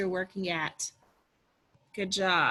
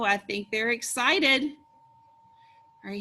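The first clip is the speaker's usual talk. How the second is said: usual speaking voice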